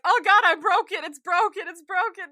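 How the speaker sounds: frantic retold wail; acted panic. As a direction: cry out like a man hurt, hammed up. Acts out injured man's panic.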